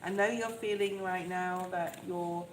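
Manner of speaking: ordinary talk, nothing marked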